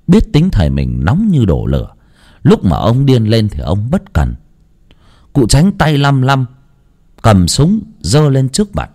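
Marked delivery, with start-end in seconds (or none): none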